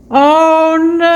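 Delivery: sudden high, loud "oh no" as something smashes; dismayed yelp at the breaking cup; shocked cry. Real, not acted. Cup just smashed on the floor, a loud shocked "oh no". Product breaks while unboxing.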